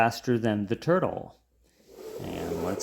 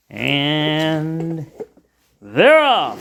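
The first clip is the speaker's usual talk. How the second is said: race caller; 3 semitones higher, 5 dB louder, much slower than usual. Acted. Starting a toy race.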